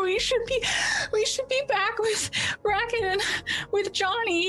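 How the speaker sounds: put-on high-pitched crying teenage girl; distraught, panicked girl in tears; hysterical acted sobbing. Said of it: "she's just sobbing and crying again".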